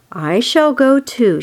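ordinary delivery, nothing marked

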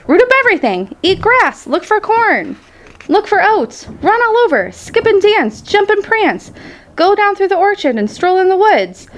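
high, bouncy list of suggestions; eager urging. A narrator's reading. Goose tells the freed pig where to roam, eager and high. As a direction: egg him on, pitched well up.

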